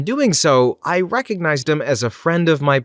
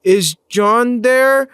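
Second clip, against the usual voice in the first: very slow, higher, louder imitation; really slow voice; 11 semitones higher, 5 dB louder, much slower than usual. Imitating a female caller asking for a coworker, really slow voice. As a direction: drag each word out in a put-on caller voice. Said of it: "In a really slow voice".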